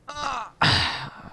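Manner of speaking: a long high exasperated sigh; a sigh of mock despair. Real, not acted. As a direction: groan out a weary sigh. Reader pausing, exasperated.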